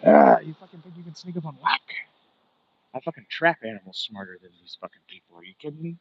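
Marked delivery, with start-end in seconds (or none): 0.0-1.8 louder, higher tough-guy taunt; mock taunt. Streamer taunting a car sneaking up behind him. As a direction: challenge the car like a backwoods hunter.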